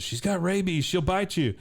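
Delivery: put-on high, anxious boy's warning; mock worried warning. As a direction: imitate a son pleading caution. Son warns father of rabid dog.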